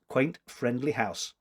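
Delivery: plain reading voice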